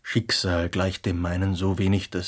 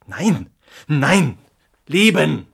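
loud, high, fervent insistence; passionate outburst; 8 semitones higher, 9 dB louder than usual. Acted. Answering the pessimist, a loud fervent protest. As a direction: cry out for life whatever the cost. Passionate reply about life's worth.

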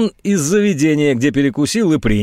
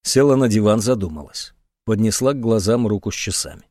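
usual speaking voice

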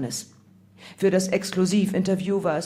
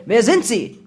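loud, forceful interrogation; bellowed demand; 3 semitones higher, 8 dB louder than usual. A narrator's reading. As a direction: bellow it at a suspect. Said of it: "brüllte".

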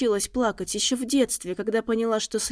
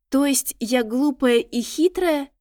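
higher, louder and slow, offended; indignant; 5 semitones higher, 4 dB louder, slower than usual. A narrator's reading. Lady takes offence at the tracker's words, indignant and slow. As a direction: bristle with outrage. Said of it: "возмутилась та".

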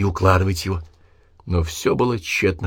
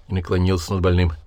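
ordinary delivery, nothing marked

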